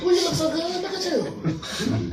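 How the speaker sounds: mock sweet-talk from his mama; flirty mama. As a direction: coo like an admiring older woman. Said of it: "even his mama sweating you".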